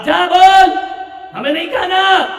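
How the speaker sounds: high, loud refusal; grumpy father shout. Retold or imitated. Angry father tells son he won't eat, loud retort. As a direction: bark back a sulky no.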